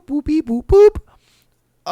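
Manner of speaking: high-pitched phone keypad noises; imitated dialling beeps. Bartender dialling for help, imitated beeps. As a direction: voice the button tones, last one loud and very high.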